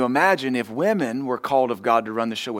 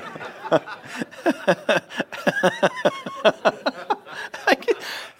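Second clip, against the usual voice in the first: laughing on and on; high giggling laugh. Real, not acted. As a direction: keep giggling. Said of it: "It's just so funny".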